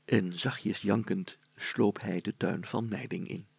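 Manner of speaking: slow, soft storytelling; hushed narration. A narrator's reading. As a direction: draw the listener in quietly.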